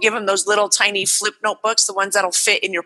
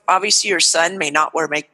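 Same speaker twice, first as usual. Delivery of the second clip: ordinary talk, nothing marked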